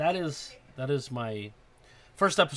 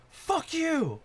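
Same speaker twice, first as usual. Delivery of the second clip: high-pitched mock yell; put-on high yell, cursing someone out. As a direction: hurl it in a much higher voice. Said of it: "starts cursing and yelling at him".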